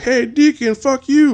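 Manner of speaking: high taunting shout; mock heckler yell. Actor yelling insults at the Deacon, mock shout. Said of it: "the guy who usually yells at the Deacon".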